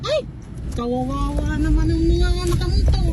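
loud, high alarmed talk; real worried excitement; agitated. Real, not acted. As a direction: speak up over the storm in alarm. Watching storm from car.

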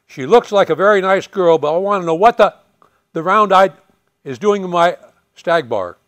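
loud, high barking order; command voice. Retold or imitated. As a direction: fill the room with a commander's shout. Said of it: "I said in my command voice".

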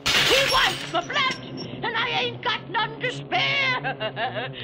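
high, panicked cries of alarm; terrified. Acted. Keeper seeing the Whir at his window, terrified. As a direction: shriek it like the monster is at the window. Keeper sees the monster outside.

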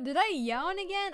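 surprised. Real, not acted. Streamer noticing her own yawn, startled and loud.

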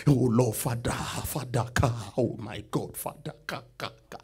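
whiny pleading, car repeated over and over; mock begging prayer. Preacher mocking members begging God for a car, whining. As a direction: parody a desperate beggar at prayer. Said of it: "Some of you will request that car".

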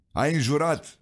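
a gatekeeper's sharp quiz; a hint of a stern questioner. A narrator's reading. Peter interrogating the miner.

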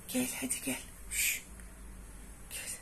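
whispered coaxing; whispered call. Real, not acted. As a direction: whisper her over. Fısıltıyla kediyi çağırıyor. (fısıltıyla çağırma)

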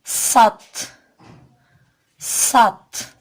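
"Sat" with a thick emphatic s, loud; Arabic letter sad. Shown as a demonstration. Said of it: "Kalın, gizli, yumuşak okunur". (kalın ve yumuşak "sat")

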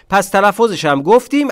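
normal talking voice

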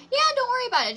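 squeaky high reassurance; high-pitched. Real, not acted. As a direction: pitch it way up, loud. Sagt Zuschauern gute Nacht.

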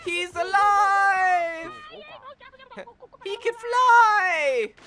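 very high, slow, loud cries of glee; delighted shout. Real, not acted. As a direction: cheer like a mad scientist.

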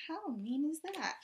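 hushed whispered aside. Real, not acted. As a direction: whisper it in disbelief. Aside to child.

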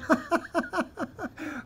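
high, acted cackle of laughter; long rattling mock laugh; hard laughing. Vaqueros laughing hard at the man's claim, mock laughter. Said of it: "all the vaqueros started laughing hard".

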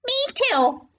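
tiny, very high little voice; high-pitched child creature piping up; young kangaroo. A narrator's reading. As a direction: squeak it like a little joey. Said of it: "the young kangaroo in her pouch said".

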